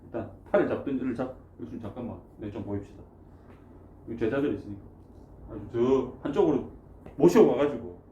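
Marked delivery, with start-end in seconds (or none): 1.6-3.0 hushed aside. Acted. Peter pulling Jesus aside.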